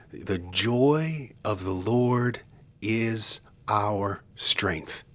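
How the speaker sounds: slow, drawn-out scripture line; weighty quotation. Retold or imitated. Preacher quoting Nehemiah to the congregation, slow and weighty. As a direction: stretch each phrase out with deliberate weight.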